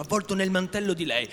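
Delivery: ordinary delivery, nothing marked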